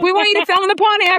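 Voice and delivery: high, loud, quick request; mock excited caller. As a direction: imitate callers pitching over a commotion. Acted callers making an excited request.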